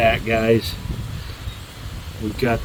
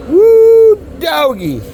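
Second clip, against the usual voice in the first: excited whoop; 13 semitones higher, 6 dB louder, much slower than usual. Real, not acted.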